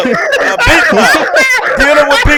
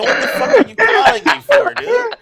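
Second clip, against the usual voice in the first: exasperated yell; 13 semitones higher than usual. Retold or imitated. Answering a too-early phone call, exasperated yell. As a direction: yell at him for calling so early. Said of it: "I'm like".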